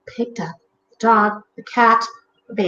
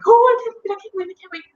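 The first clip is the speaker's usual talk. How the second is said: high, buzzing with impatience; giddy high-pitched anticipation; excited fairy queen; 9 semitones higher, 3 dB louder, faster than usual. Acted. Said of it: "buzzing around like".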